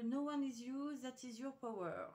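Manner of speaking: English title in a French accent. Real, not acted. Craft vlogger reads a product name to viewers, French-accented English. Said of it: "mon accent anglais".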